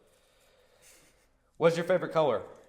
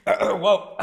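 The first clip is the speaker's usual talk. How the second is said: lion voice; 8 semitones higher, 9 dB louder than usual. Shown as a demonstration. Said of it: "I'm gonna do that again".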